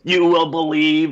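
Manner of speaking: lightly acted overpowering spirit command; possessing force. Imaginary force taking over the speaker in bed, mock menacing. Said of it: "something that was choking me going".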